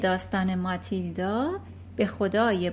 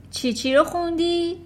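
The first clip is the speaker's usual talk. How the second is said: higher, louder disbelieving demand; incredulous headmistress; 8 semitones higher, 5 dB louder than usual. Acted. Headmistress doubting the little girl, louder. Trunchbull questions Matilda.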